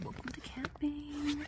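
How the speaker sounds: soft laugh into the words; whispered laugh. Real, not acted. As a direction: chuckle under your breath.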